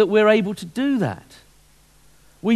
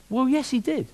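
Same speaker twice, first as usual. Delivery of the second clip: woman customer; 6 semitones higher, 3 dB quieter than usual. Acted. Woman voiced in joke.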